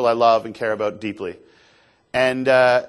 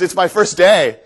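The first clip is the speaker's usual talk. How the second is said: loud, higher, imitating the excited guide; gleeful outburst; 5 semitones higher, 7 dB louder, slower than usual. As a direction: burst out with a newcomer's enthusiasm. Guide explains his huge energy.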